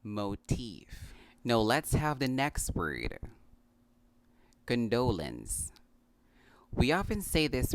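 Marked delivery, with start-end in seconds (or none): 0.0-1.1 "motif" as moh-TEEF; correct pronunciation. Shown as a demonstration. 4.6-5.7 "Condolence" as kun-DOH-luns; slow model "Condolence", stress on the second syllable; correct pronunciation. Shown as a demonstration. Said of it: "The correct pronunciation of this word".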